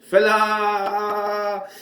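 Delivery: high, loud, hammed-up Irish accent; terrible Irish accent. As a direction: ham up a bad Irish brogue, calling out a rival. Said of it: "That was a terrible Irish accent".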